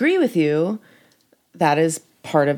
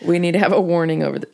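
ordinary talk

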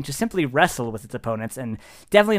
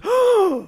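sharp, high mock gasp; crowd gasp; 4 dB louder than usual. Everyone watches you jump into the ring, gasping. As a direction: gasp like a shocked audience. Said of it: "everyone goes".

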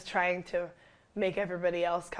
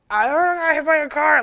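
high, loud dazed cry for help; frantic plea; 9 semitones higher, 12 dB louder, faster than usual. Retold or imitated. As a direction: blurt it out to the paramedics in shock. Dazed victim telling ambulance.

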